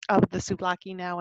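ordinary delivery

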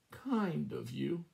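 mock-feeble croak, drawn out slowly; a slow, frail little croak; weak croak. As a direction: croak it like a sick old hen. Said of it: "she croaked in a weak voice".

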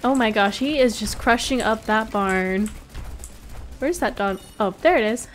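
ordinary delivery

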